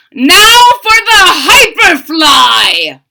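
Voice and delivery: high, loud eager doctor; booming mad scientist scheme. Acted. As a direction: gloat over the next plan. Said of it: "said Doctor Franken".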